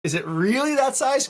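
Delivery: high, loud, doubting question; retold incredulity. Customer asking shop about size, retold incredulity. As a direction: press the doubt in an exaggerated high voice.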